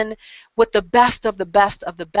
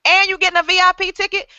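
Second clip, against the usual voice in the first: higher, rising squeals of mock disbelief; mock amazement; 8 semitones higher, faster than usual. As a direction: hype up each perk in pretend shock. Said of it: "I'm saying".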